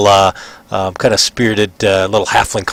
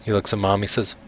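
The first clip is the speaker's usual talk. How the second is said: usual speaking voice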